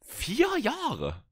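high, drawn-out disbelief; can't believe how long it's been; surprised. Real, not acted. Disbelief at four years. (ungläubig überrascht)

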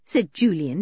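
ordinary delivery, nothing marked

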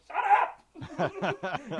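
high, loud mock outburst; retold angry yell. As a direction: snap at a singing friend, half laughing. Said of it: "I was about to punch him".

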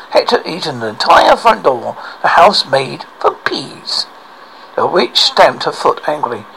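ordinary delivery

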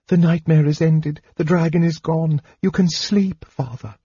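high, urgent soothing of a father; high-voiced anxious daughter; pleading. A narrator's reading. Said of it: "pleading for him to be silent".

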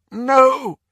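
loud, high outcry; nightmare cry. Acted. Dreamer shouting in his sleep at a vision, distressed.

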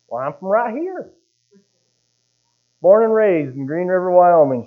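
high, imitated Alabama accent. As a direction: mimic someone with an Alabama accent. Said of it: "She talks with an Alabama accent".

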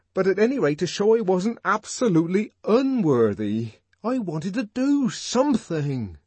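high, loud, impassioned explanation; fervent, strained outburst; agitated pleading. A narrator's reading. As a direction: plead to be understood, voice pushed up. Savage anxiously explains himself to her.

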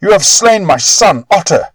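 high, loud grieving shriek. Acted. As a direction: shriek it out over your dead son. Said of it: "shrieked the old man".

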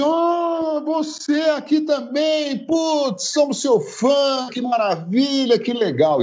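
high excited fan voice; gushing fan. Retold or imitated. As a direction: gush like a starstruck fan.

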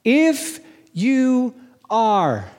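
slow, high, drawn-out challenge; devil's challenge. Acted. The devil tempting Jesus in the wilderness, slow high challenge. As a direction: stretch each word out as the tempter. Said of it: "what's the attack of the devil".

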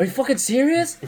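high-pitched incredulous question; mock astonishment; retold disbelief. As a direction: imitate your own amazement, voice shooting up. Retold disbelief at having played.

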